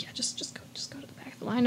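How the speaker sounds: hushed, breathy aside to the customer; imitating a cashier's quiet whisper; retold whisper. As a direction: whisper it so she won't hear. Lady quietly sends woman back.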